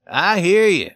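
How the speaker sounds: firm answer. A narrator's reading. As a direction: answer the shouter firmly and loudly. Keeper answers the shouting Swede.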